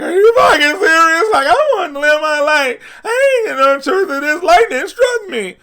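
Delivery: high, loud mock wail; crying and whining. Barry freaking out at the Speed Force, crying and whining. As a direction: shout it in a hammed-up tearful whine. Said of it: "crying and whining".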